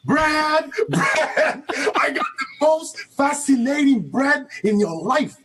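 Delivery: high, laughing vendor's call over the crowd; street vendor calling. Retold or imitated. Boy selling bread downtown, calling out to passing workers, high and laughing. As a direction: hawk bread from the bicycle, grinning. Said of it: "and I'll be like".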